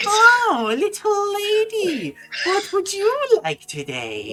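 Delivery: high cackling crone voice; old witch voice. Acted. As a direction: play a sly old shopkeeper witch, slow. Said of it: "old lady ... goes".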